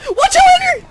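high, very loud yell; frantic shouted alert; urgent warning shout. Real, not acted. Warning teammate in game.

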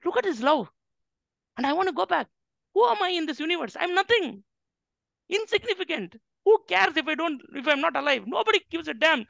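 despairing self-talk. Acted. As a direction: speak as someone who thinks nobody would miss him. Despairing inner voice acted out.

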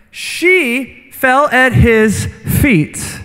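drawn-out, deliberate "she fell at his feet"; slowed right down for weight; slow emphasis. Acted. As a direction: stretch every word, letting "fell" sink. Preacher lingers on the verse.

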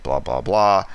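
ordinary talk, nothing marked

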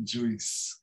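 "Juice" with an extra i, JOO-ees; the learners' error: "Juice" as joo-ees, softly; mispronounced juice. Shown as a demonstration. Teacher echoing the common mistake, "Juice" said JOO-ees. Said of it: "nós queremos muito dizer juice".

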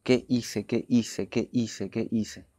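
hushed, stunned regret, retold; retold bitter lament. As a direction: replay a tearful lament quietly, without crying. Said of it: "amargamente estaba llorando".

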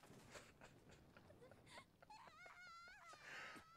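a long shrill laugh close to tears; high wheezing laugh. Real, not acted. Finding out it was his pet, a helpless wheezing laugh. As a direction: break down laughing, high and breathless.

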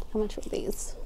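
a quick whispered question; whispered to self. Real, not acted. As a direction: mutter the question under her breath.

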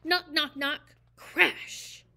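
loud knocking. A narrator's reading. Narrator acts out the knocking at the door. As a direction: bang on the door.

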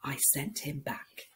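grumbling whisper; hushed storybook whisper. Acted. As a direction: whisper it like a grumpy secret. Acting the grumpy camel.

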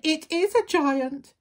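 high, loud little-wolf shriek; frightened shriek. A narrator's reading. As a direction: shriek in alarm as a small scared animal. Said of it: "shrieked the little wolf".

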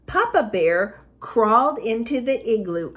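plain reading voice

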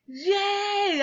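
high, drawn-out yay; shouted cheer. A narrator's reading. Classmates cheer buying refrigerators, shouted. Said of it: "everybody shouted".

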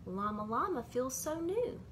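ordinary delivery, nothing marked

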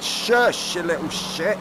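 sharp high shush at the puppy; loud scolding shush; telling off the dog. Real, not acted. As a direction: hush the pup, loud and high.

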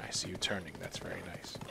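whispered praise; soft whisper. Real, not acted. Streamer praises horse turning, whisper. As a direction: breathe it gently to the horse.

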